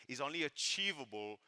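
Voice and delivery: ordinary talk, nothing marked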